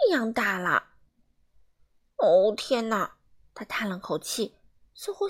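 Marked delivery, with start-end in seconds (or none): 2.2-3.2 dismayed sigh. Acted. Mr Rude shrinks.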